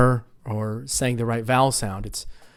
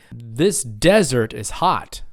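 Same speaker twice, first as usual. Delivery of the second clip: slow model line, DEZ-ert stressed in desert; desert as DEZ-ert, for students to repeat; first-syllable stress; slower than usual. Shown as a demonstration. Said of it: "everybody say".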